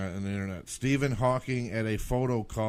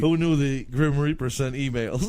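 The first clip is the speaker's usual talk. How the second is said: normal talking voice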